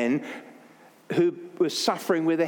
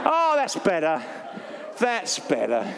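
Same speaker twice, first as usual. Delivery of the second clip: real laughter through the words; laughing, pleased the joke landed; chuckling with relief; relieved laugh. Real, not acted. Congregation finally laughs.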